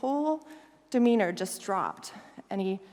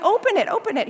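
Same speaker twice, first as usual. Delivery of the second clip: eager; 9 semitones higher, 5 dB louder, faster than usual. Retold or imitated. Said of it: "I'm like".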